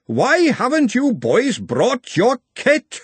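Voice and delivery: high, slow, booming roar. A narrator's reading. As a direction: roar it at the boys like a furious games master. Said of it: "and he roared".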